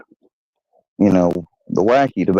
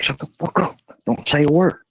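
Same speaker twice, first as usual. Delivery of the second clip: higher, tense, held down; hissed order; 5 semitones higher than usual. Retold or imitated. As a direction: snap at him to shut up while hiding. Friend silencing him, tense.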